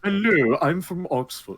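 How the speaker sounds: laughing mock posh English accent. Friends mocking a posh Englishman, laughing posh English accent. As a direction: put on a snooty Oxford English accent. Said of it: "posh fucker".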